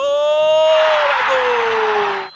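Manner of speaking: goal shout. Real, not acted. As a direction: scream the goal. (grito de gol)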